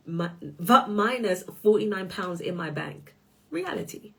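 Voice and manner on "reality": "Reality" jumps up high as a punchline; "Reality" said high and airy; emphatic high reality. Acted. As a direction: sing out "Reality" high and soft.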